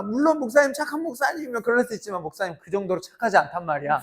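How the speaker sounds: ordinary talk, nothing marked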